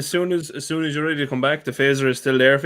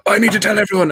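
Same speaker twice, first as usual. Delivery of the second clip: higher and louder, bursting to tell everyone; eager blurt; 9 semitones higher, 4 dB louder than usual. Retold or imitated. Sharing a secret bike with friends, mock eager blurt. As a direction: blurt it out like a secret you can't keep. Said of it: "I'm like".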